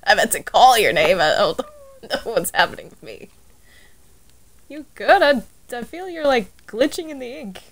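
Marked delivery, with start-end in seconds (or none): none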